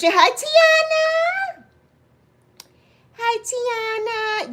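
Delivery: very high, slow drawn-out hello; high slow sing-song greeting. Real, not acted. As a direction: sing out the name slowly.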